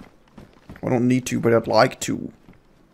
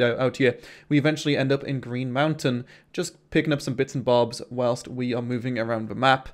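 voiceover narration; 6 dB quieter than usual. Acted.

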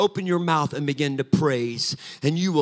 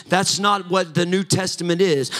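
louder, higher preaching with a musical lilt; preaching at full force; 3 semitones higher, 7 dB louder than usual. Acted. Pastor rousing the congregation, near-sung full-force preaching. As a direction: lift it into a near-sung sermon climax. Preaching climax on the torn veil.

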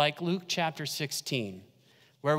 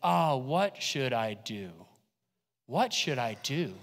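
mild worried lament; 6 semitones lower, 3 dB quieter than usual. Acted. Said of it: "what it actually says is".

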